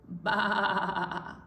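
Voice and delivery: long quavering baa; lamb bleat. Acted. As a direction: bleat it out like a sheep. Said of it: "Lamb said".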